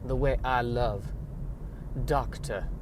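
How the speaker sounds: slow Southern belle drawl; languid, melodramatic Southern accent with long pauses; Blanche Dubois. Pretend. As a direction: play a badly faked Southern belle. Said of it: "I was aiming for Blanche Dubois".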